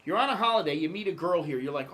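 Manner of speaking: ordinary delivery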